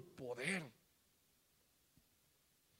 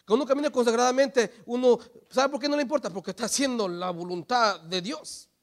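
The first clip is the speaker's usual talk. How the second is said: loud, raised sermon voice; forceful preaching; 5 semitones higher, 12 dB louder than usual. Acted. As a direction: drive the point home to the congregation.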